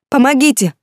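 high, loud desperate cry; screaming for help. A narrator's reading. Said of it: "закричала я".

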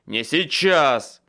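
loud, slow, harsh bark; barked refusal. A narrator's reading. Wizard holding a spell, barks at Michael to wait. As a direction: snap it out, no time for this. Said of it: "рявкнул я".

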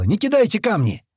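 high, loud order; full-throated yell. A narrator's reading. Ordering workers to stop throwing stones, yelling. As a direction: yell it over the crowd. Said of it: "ору во всё горло".

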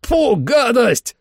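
high, drawn-out yelp of disgust; high grossed-out wail; disgusted yell. A narrator's reading. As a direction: yell out in revulsion, stretching it slowly. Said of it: "завопил Трой".

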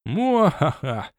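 slow, drawn-out dark lord cackle; evil villain laugh. A narrator's reading. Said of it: "злобный смех классического тёмного властелина".

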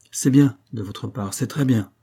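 usual speaking voice

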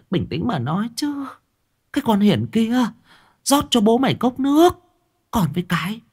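high anxious wife calming her husband; pleading wife. A narrator's reading. Wife restraining angry husband, high pleading voice. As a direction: hold him back, soothe and scold at once. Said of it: "níu lại".

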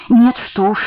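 plain reading voice

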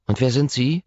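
put-on a flustered young nurse. Young nurse asking the charming visitor who he is.

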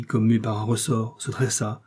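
ordinary delivery

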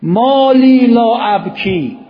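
loud, high, drawn-out Arabic recitation; impassioned recitation. Acted. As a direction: cry out the supplication, stretching every word. Recites an Arabic lament line.

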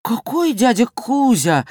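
мальчишеское недоумение, повыше; переспросить детским высоким голосом; удивлённо. A narrator's reading. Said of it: "удивился Жорик". (с лёгким удивлением)

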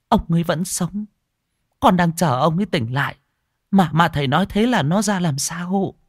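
put-on high, pleading old woman's voice; anxious wife pleading. Wife begging the monk to explain, high and anxious. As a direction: press the monk for answers, worried and high.

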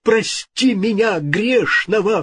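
high-pitched terrified plea; cry of horror. A narrator's reading. King begging God's forgiveness, horrified. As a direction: beg for mercy, drawn out and high. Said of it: "вскричал в ужасе король".

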